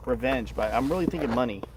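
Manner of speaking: ordinary delivery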